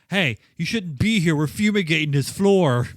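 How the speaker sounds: loud official warning. Retold or imitated. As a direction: call it out like a guard. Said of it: "It's like".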